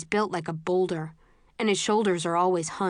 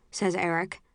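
ordinary delivery

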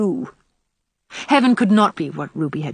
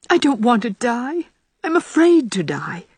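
frightened, higher-pitched plea; pleading; 5 semitones higher than usual. A narrator's reading. Ruby telling her friend she fears death, pleading. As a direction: plead with fear in a raised pitch. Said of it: "pleadingly, impulsively".

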